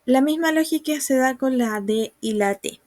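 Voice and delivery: ordinary talk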